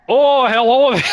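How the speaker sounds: loud, high Scottish greeting; attempted Scottish accent. Gamer voicing a game character in Scottish accent. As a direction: belt it out in a hammed-up Scottish accent. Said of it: "get into my Scottish accent".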